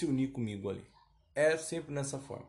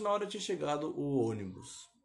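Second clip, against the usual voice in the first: ordinary talk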